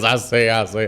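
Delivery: lightly voiced cartoon rooster, with a laugh; a hint of the blustery cartoon chicken; Foghorn Leghorn. To his friend, quoting the cartoon rooster with a laugh. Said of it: "he's the one that goes".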